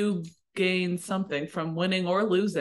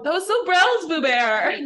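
teasing; 8 semitones higher, 5 dB louder than usual. Pretend. Teasing with new slang.

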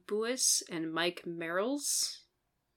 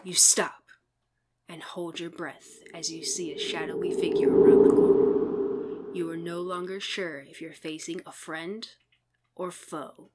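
hushed, suspenseful storyteller voice; spooky narration; 6 dB louder than usual. Acted. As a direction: whisper it like a ghost story.

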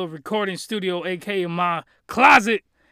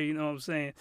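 ordinary talk, nothing marked

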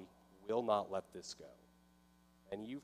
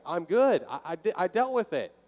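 triumphant relief; 6 semitones higher, 8 dB louder than usual. Retold or imitated. Triumphant retold relief after Freedom yell.

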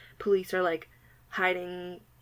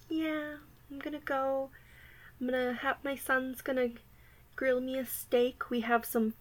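put-on older lady; 6 semitones higher than usual. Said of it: "I used to work with this older lady".

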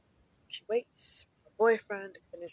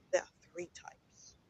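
whisper; 8 dB quieter than usual. Acted. Secret aside to listeners.

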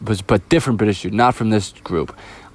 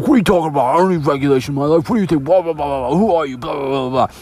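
higher, mock-angry rant imitation; mocking Jordan; 5 semitones higher, faster than usual. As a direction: parody him ranting back, pitched up.

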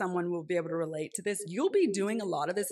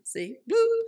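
playful bloop; 14 semitones higher, 5 dB louder than usual. Real, not acted. Playful sound effect interjection.

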